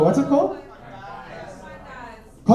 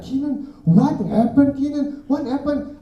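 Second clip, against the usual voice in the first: high worried mom calling his name; anxious mother demanding answers; 10 semitones higher, 4 dB louder, slower than usual. Retold or imitated. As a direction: play his frantic mother, pitched way up. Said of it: "my mom was like".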